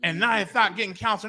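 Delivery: ordinary talk, nothing marked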